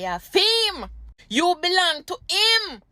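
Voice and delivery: high, drawn-out mock declaration; possessive claim. Jamaican man telling a woman she is his, possessive.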